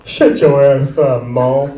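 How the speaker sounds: loud mock challenge from a childhood friend; imitating friend Leon. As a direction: lightly voice a kid talking tough, loudly. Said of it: "that's how he talked".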